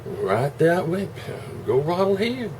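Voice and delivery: high, slow mock invitation; sweetly sarcastic go-ahead.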